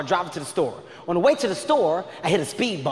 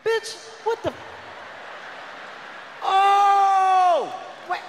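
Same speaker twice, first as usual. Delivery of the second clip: much higher, the Oh shouted; outraged disbelief; 11 semitones higher, much slower than usual. Retold or imitated. Boyfriend stunned by girlfriend offering food, shouted disbelief. Outraged reaction to her calm.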